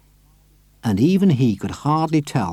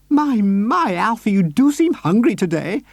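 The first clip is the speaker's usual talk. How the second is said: much higher doting lady; Missus Silver's voice; 10 semitones higher, 3 dB louder than usual. A narrator's reading. Missus Silver fussing over her tortoise, high and doting. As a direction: fuss over her pet.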